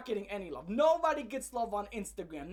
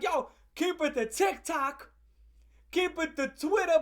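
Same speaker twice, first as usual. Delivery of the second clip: mock advice voice; 7 semitones higher, 7 dB louder than usual. Telling her to stay on TikTok, a mild mock call. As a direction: call it out like a buddy giving advice.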